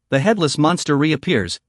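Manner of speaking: plain reading voice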